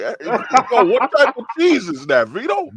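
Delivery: nervously trying to joke; nervous joking. Retold or imitated. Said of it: "And you're like".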